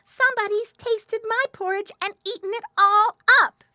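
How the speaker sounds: very high, loud squeaky little bear; piping little bear. A narrator's reading. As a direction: pipe it up high like a tiny bear. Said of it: "the little bear piped".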